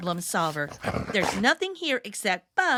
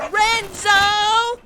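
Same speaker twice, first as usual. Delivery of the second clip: high, slow, loud cry of the name; exasperated shout; 9 semitones higher, 9 dB louder than usual. Acted. Girl shouting as dog eats her lemon. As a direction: yell out in dismay.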